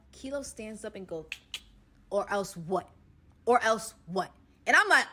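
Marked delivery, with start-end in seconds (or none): 2.1-4.3 menacing challenge. Retold or imitated. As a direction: square up and dare him. Kilo challenges Bank Robber Bay.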